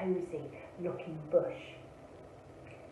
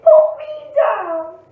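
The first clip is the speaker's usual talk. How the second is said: high, loud rabbit demand; slow, full-voice shout to be released; 14 semitones higher, 19 dB louder, slower than usual. A narrator's reading. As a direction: yell it high, drawn out. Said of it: "shouted the rabbit".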